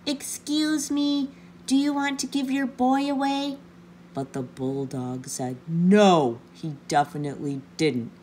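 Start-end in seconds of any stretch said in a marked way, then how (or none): 0.1-3.6 polite sing-out call to a stranger; loud, polite call. A narrator's reading. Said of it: "he called out loudly, but very politely". 5.7-8.1 booming NO, then low and firm; mildly gruff storybook bulldog saying no; cross refusal. A narrator's reading.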